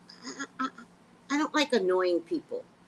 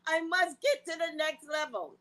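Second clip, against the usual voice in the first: mock pleading; 7 semitones higher than usual. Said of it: "I was like".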